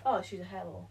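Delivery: ordinary talk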